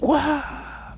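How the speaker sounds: very high, drawn-out mock amazement; awestruck. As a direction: marvel in a high-pitched wow. Acts awe at seeing the fish.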